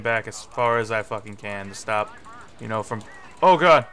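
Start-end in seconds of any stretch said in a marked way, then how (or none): 3.4-3.9 loud sudden oh god; startled yelp. Real, not acted. Gamer startled by a grenade, sudden yelp.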